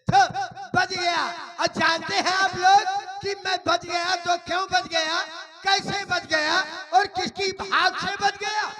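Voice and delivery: high-pitched shouted proclamation; stage-loud rhetorical questioning; loud declaiming. Acted. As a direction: put the riddle to the whole crowd at full voice. Declaiming his riddle to the audience.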